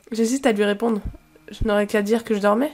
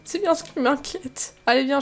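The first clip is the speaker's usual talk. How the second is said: usual speaking voice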